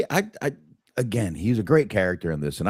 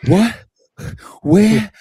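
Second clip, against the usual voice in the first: much higher, much louder What and Where; surprised; 13 semitones higher, 10 dB louder than usual. Real, not acted. Reacting to a friend's claim, startled outburst. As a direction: jolt up in sudden disbelief.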